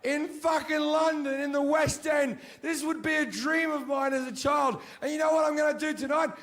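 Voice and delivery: high, loud, wailing shout of self-pity; hammed-up anguished yelling; despairing rant. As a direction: rant it out like a man at the end of his rope. Mock-despairing comic rant.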